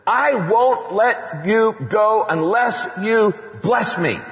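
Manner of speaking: high shouted plea, slow; desperate shouted plea to God; passionate cry. Acted. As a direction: cry out to God, refusing to let go. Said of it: "Jacob panted".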